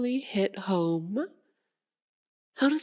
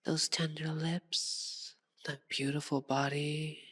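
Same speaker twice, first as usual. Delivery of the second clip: seductive murmur; 3 semitones lower, 7 dB quieter than usual. Acted. Vampire admiring captive hunter, seductive murmur. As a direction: murmur it, savouring the prey.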